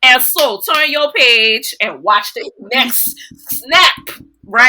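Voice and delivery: drawn-out, loud New Orleans instructor voice; slow, loud New Orleans teaching voice. Shown as a demonstration. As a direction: give the class instruction slowly and loudly in your home accent. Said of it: "when I've walked into a room".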